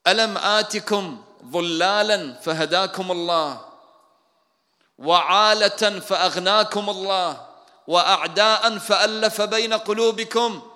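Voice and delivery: loud, high, slow ringing oration; oratorical reproach. Retold or imitated. Prophet addressing the Ansar, loud solemn reproach. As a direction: proclaim each question slowly at full voice. النبي يخطب في الأنصار معاتبًا.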